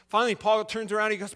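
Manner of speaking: ordinary delivery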